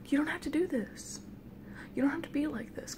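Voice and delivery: soft, high-voiced pleading; gentle plea. Dreamer pleading with the lady gnome, soft plea. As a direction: plead softly, close to a whisper.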